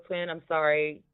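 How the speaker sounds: ordinary delivery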